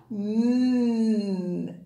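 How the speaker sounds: "Mm" hummed as a long nasal mm; the n sound. Shown as a demonstration. Teacher modelling the letter N sound "Mm" for the class. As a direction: hum "Mm" for the n sound, lips closed. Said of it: "It begins with".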